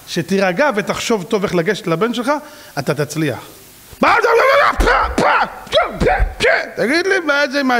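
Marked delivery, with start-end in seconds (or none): 4.0-7.8 shrill mock outrage at a son; mock outraged shout. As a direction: hurl the question like an outraged father. Acted outraged father shouting.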